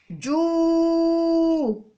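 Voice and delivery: long held جو, almost sung; drawn-out syllable ju. Shown as a demonstration. Teacher modelling the sound for children, held long. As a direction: sing out جو for the class. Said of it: "الجيم".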